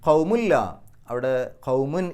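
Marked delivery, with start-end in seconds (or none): 0.0-0.5 ഖൗമുല്ല with the n fully merged into a doubled l; ഖൗമുല്ല as qaumu plus a doubled l; merged without nasal. Shown as a demonstration. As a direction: say ഖൗമുല്ല, no hum on the n. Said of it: "പക്ഷേ മണിക്കാൻ പാടില്ല".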